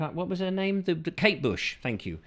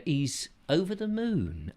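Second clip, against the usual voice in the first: ordinary talk, nothing marked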